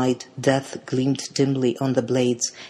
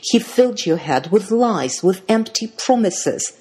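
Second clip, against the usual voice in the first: higher, bitter accusation; loathing; 6 semitones higher, 4 dB louder than usual. A narrator's reading. Middle sister denounces lover to sister, loathing. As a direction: accuse with rising contempt. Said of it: "voice was shaking with loathing".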